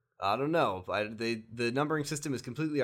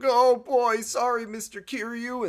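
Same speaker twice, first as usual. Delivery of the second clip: mock scared punks; 11 semitones higher, 6 dB louder than usual. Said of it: "the guys are like".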